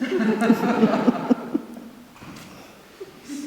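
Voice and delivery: long breathy laugh, high and loud; airy rising laughter; loud real laugh. Real, not acted. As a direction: laugh it off heartily. Laughs ending the anecdote.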